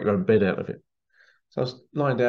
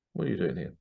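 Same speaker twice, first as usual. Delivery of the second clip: put-on a quieter, lower posh British accent; 4 semitones lower, 7 dB quieter, faster than usual. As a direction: ask it like a plummy British official. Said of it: "With a very posh kind of British accent".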